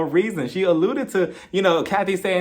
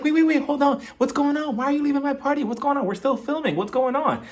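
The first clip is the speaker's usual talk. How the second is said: high, pleading hostess voice; mock frantic Crystal; 7 semitones higher than usual. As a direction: pester her with worried questions. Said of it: "she was like".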